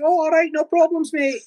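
high-pitched put-on Scottish local; local Neds backing off. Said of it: "They said".

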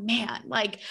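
ordinary delivery

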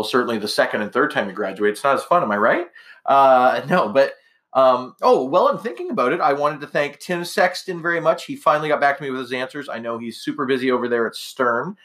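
ordinary talk, nothing marked

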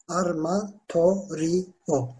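"Armatorio" slowly, in separate syllables. Shown as a demonstration. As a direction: break "Armatorio" into drawn-out syllables. Teacher breaks the word into syllables. ("Armatorio" kelimesini yavaşça hecele)

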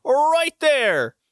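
hammed-up ringside ruling; commissioner's call. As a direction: imitate the official pointing and calling it. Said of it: "he points at Saito and goes".

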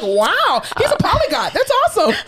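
high-pitched, laughing marvel; mock amazement. Therapist marveling at a patient's languages, high mock amazement.

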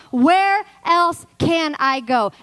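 high, loud, drawn-out preaching cry; impassioned declaration. Acted. Preacher declaring she will stay, loud and impassioned. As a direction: cry it out slowly from the pulpit.

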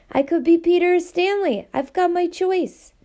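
a schoolboy begging to stay, rising hope; pleading boy Luke. A narrator's reading. Luke offers a new identity.

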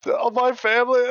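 high, strained dying soldier plea; wounded soldier gasping. Retold or imitated. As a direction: cry out like a fallen soldier. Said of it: "they're like".

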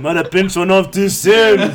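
high, loud, drawn-out character voice; hammed-up slow confession, laughing; Fat Bastard. Admitting he soiled himself, loud Fat Bastard impression. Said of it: "I'll do fat bastard".

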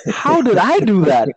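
high-pitched, disbelieving question; incredulous protest. Retold or imitated. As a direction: act baffled at being blamed. Retold reaction to accusation.

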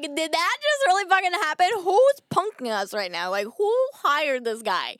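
loud, high-pitched disbelief; acted-out bewildered outburst; perplexed. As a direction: retell it as stunned, loud incredulity. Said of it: "I was fucking perplexed".

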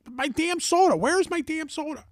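high-pitched imitation of an indignant six-year-old; huffy child's repeat. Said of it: "She's getting all huffy and puffy".

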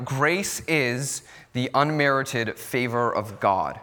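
grace is the unmerited favor of God, said slowly for note-taking; slow dictation. Shown as a demonstration. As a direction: dictate it slowly so they can write it down. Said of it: "you can write this down".

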